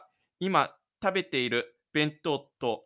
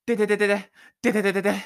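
running sound; 4 semitones higher, 5 dB louder, much faster than usual. Acted.

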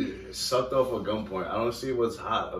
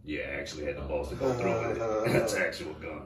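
mock woman's line; 6 semitones lower, 5 dB quieter, faster than usual. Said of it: "She goes".

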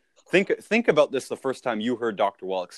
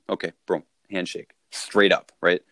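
usual speaking voice

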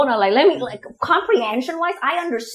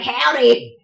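mock Southern "howdy"; imitated American accent; 3 dB louder than usual. Friend imitates people faking American accents, Southern howdy. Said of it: "they try to do an imitation of the American accent".

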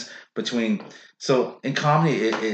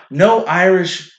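usual speaking voice

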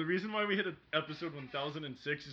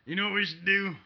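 put-on very old man; 4 semitones higher, 5 dB louder than usual. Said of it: "turned into my old man voice".

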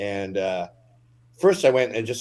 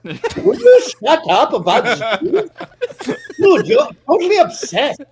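much higher, louder, laughing exasperated outburst; exasperated yell; 8 dB louder than usual. Acted. Young Hitler shouting at his mentor, laughing exasperated yell. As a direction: blow up at the nag, half laughing.

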